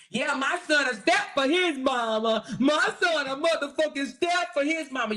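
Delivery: mock her bragging; mocking mother.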